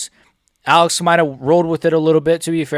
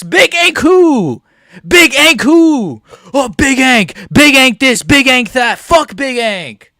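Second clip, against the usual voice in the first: slow drawn-out gloating yells, higher and louder; taunting shout; 7 semitones higher, 6 dB louder, slower than usual. Real, not acted. Fan gloating at Ankalaev after the knockout, shouting. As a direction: chant it to mock the loser.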